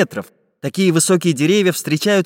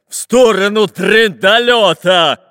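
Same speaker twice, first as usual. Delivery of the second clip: loud, drawn-out roar, pitched up; pirate's bellow; 7 semitones higher, 6 dB louder, slower than usual. A narrator's reading. Fat captain telling Dyrka where to run, roaring. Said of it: "рявкнул толстый капитан Буль-Буль".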